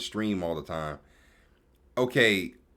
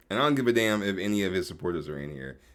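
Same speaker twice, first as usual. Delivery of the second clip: ordinary delivery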